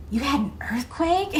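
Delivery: light imitation of thrilled surprise; excited. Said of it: "He got so excited".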